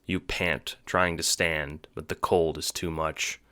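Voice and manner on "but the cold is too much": ordinary talk, nothing marked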